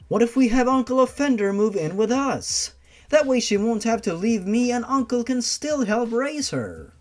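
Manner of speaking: a hint of a hopeful daughter; gently pleading girl suggesting a plan; young daughter. Daughter voiced in story.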